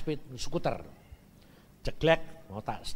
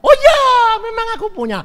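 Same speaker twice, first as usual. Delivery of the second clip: excited seller; 15 dB louder, faster than usual. Retold or imitated. Seller answers about the house.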